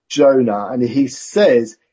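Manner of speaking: usual speaking voice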